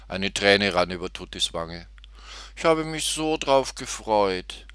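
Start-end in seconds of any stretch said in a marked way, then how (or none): 2.5-4.6 higher, slow, plaintive little voice; sorrowful piglet. A narrator's reading. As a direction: let the disappointment drag the words out. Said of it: "sagte sie bekümmert".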